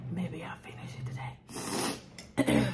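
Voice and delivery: slow breathy whisper to herself. Real, not acted. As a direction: murmur it slowly under your breath. Talking to herself mid-meal.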